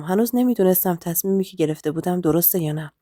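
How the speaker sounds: usual speaking voice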